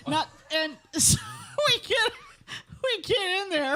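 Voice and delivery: words tumbling out through laughter; laughing while telling. Real, not acted. Laughing while retelling story.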